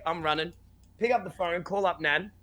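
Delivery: usual speaking voice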